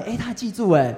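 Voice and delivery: normal talking voice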